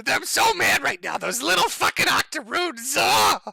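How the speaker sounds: high, loud mock-furious scream; Richard Spencer. Streamer mimicking a leaked rant for his chat, mock furious scream. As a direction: imitate a raging tantrum, voice shooting up into a yell. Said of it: "I love it when he screams".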